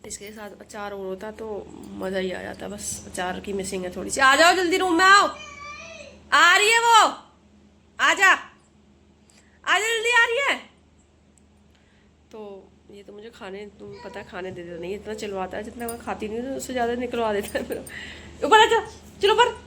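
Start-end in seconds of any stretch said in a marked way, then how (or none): 4.2-10.5 loud, high call to come. Real, not acted. Mother calling her toddler into the room as rain comes, loud high call. 18.5-19.5 loud, high call upstairs. Real, not acted. Mother calling her toddler to come upstairs, loud high call.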